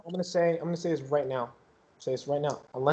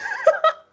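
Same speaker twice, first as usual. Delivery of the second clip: high laugh; 5 dB louder than usual. Real, not acted. Laughing at GM joke.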